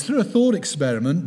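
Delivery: usual speaking voice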